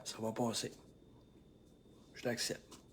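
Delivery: soft, almost whispered reassurance; calm acceptance. Shown as a demonstration. Coach demonstrating calm self-talk, softly whispered. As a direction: breathe it out gently. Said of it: "Je vais vous montrer comment vous".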